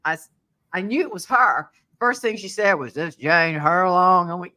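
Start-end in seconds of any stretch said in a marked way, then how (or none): 2.9-4.3 slow, loud imitation of a tough smoker; smoker's voice. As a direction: put on a tough Southern smoker, drawn out. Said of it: "she had that smoker voice".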